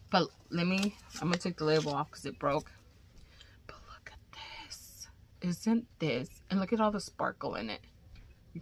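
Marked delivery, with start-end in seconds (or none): 3.7-5.1 hushed aside; whisper. Real, not acted. Showing viewers the sparkle, whispered. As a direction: drop to a whisper to show it off.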